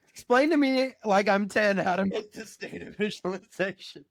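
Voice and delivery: laughing through the words. Real, not acted. Telling the co-host while laughing at herself. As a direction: giggle as you say it.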